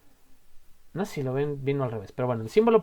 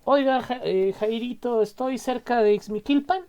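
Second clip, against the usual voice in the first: higher, louder, calling to someone down the road; calling out a question; 7 semitones higher, 3 dB louder than usual. Acted. Asking Jairito the way to Ixmiquilpan, called out higher. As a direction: hail a passerby for directions.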